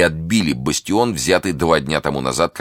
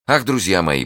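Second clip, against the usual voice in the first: usual speaking voice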